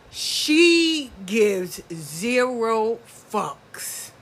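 slow, heavy emphasis; drawn out. Real, not acted. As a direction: stretch every word out. Emphatic repeat of her point.

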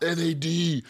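high drawn-out hype; mock hype. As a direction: boast like a cocky fan.

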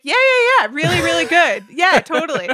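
high, loud, over-eager fake agreement; fake enthusiasm. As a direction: gush insincerely to please them. Feigning agreement about loved movie.

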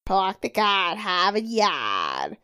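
put-on slow Boston accent, r dropped. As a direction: draw out "Park the car, have a yard" in Boston, no r. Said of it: "gnarly Boston accent".

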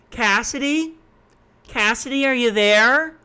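high, rising roll-call voice; calling on someone. Retold or imitated. Instructor calling on a sleeping student over Zoom, mock calling out. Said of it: "call on them for a minute straight".

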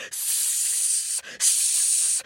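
hissing like a goose; a long angry goose hiss. Acted. Geese hissing.

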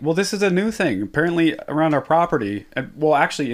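usual speaking voice

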